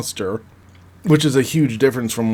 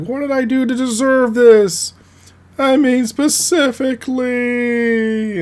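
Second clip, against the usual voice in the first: high, drawn-out put-upon villain whine; whiny Jack; 5 dB louder than usual. Retold or imitated. Jack trapped on the collapsing star, high slow whine. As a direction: play him wounded and disbelieving, slow. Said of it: "And he's like".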